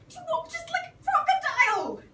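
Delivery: high loud delighted cry; excited exclaim. Acted. George chasing the platypus, excited shout. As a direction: blurt out the discovery.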